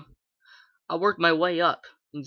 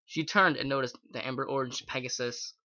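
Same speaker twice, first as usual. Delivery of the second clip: usual speaking voice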